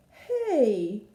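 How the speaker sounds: loud, high outraged demand; Mister Chan catching the eaten congee, loud and indignant. A narrator's reading. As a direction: demand an answer angrily. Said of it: "demanded Mister Chan".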